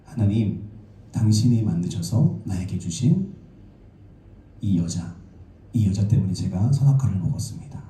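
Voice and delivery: low, measured complaint to God; lightly acted blame. Adam's blaming words acted out.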